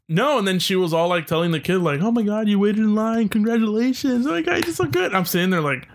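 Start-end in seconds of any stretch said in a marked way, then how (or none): 2.0-5.1 high, gushing praise like a doting mom. Retold or imitated. Mom gushing over kid after waiting in line, rising praise. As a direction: overdo the congratulations, voice climbing.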